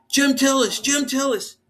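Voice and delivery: high loud call across backstage. Retold or imitated. Fan calling his name backstage, loud high call.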